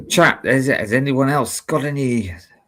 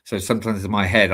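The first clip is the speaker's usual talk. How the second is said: usual speaking voice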